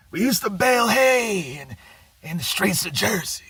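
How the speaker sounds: high mock Midwest twang on Bruce; Oklahoma-Midwest twang. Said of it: "He kinda talks like this".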